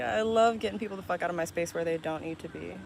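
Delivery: ordinary talk, nothing marked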